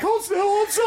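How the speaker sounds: high, urgent mock command; mock wife's urging. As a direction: play the wife hushing him. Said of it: "My wife's like".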